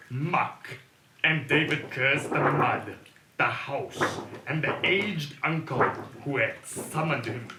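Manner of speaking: slow, dramatic horror narration; spooky audio drama narrator; story narration. A narrator's reading. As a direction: paint a dark, foreboding scene.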